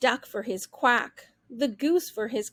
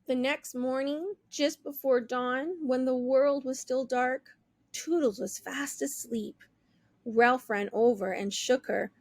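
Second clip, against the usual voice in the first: plain reading voice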